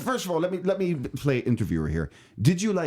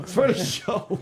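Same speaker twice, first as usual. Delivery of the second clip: mock girlfriend; 10 semitones higher, slower than usual.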